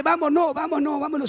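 quick insistent back-and-forth, imitating kids tugging to leave; pleading children. Children tugging at their mothers to leave.

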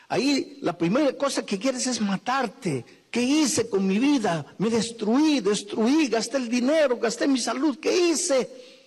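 high, urgent preaching to the congregation; full-force preaching. Acted. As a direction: preach it out, pleading and rising.

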